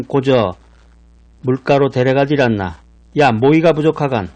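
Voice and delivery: punchy northern Pyongan delivery; Pyongan dialect. Shown as a demonstration. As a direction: hammer the Pyongan endings with a grin. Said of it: "평안도 방언으로 말하면".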